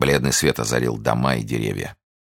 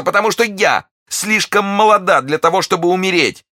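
shrieking very high, loud; terrified cry; 5 dB louder than usual. A narrator's reading. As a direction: struggle and cry out in terror. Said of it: "вскричала миледи". (отчаянный крик ужаса)

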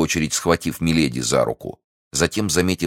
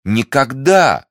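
second никогда much higher and louder; climbing cry; 11 semitones higher, 6 dB louder, much slower than usual. A narrator's reading. Milady swearing to the novice, rising desperate cry. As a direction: push the second никогда up to a near scream.

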